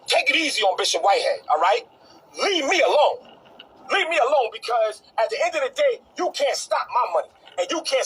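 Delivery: loud, hard warning to back off; angry shouted warning at a rival preacher. Real, not acted. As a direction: threaten him, loud and hot.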